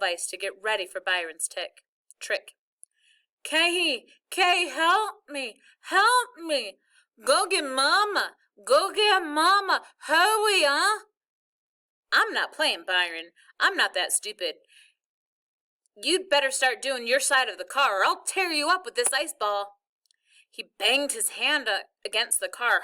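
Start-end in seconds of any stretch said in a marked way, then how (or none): 3.4-11.1 high, loud, slow desperate pleading; panicked begging. Acted. Brother stuck, begging in panic. 12.1-19.8 annoyed. Acted. Annoyed kid threatening brother.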